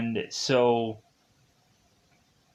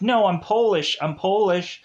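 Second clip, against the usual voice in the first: high, loud pleading protest, retold; urgent protest; 6 semitones higher, 4 dB louder than usual. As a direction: mock-desperate plea to hold fire. Pleading while being shot at.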